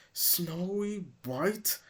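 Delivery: slow hissed question; hissing. Acted. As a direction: hiss the name in disbelief. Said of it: "Kingsley hissed".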